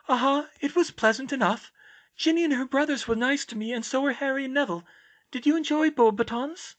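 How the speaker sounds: high-pitched, airy character voice; dreamy high girl's voice; serene oddball girl. Acted. Voices Luna in dialogue.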